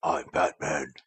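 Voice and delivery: low, hushed superhero voice; Kilmer's whispery Batman. Retold or imitated. Showing how Kilmer played Batman, low hushed voice. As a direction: drop it low and soft. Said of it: "Kilmer kinda did too".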